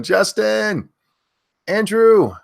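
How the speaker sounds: loud high name shout; screaming names. Real, not acted. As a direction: belt the viewer's name out. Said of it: "I have to stop screaming that".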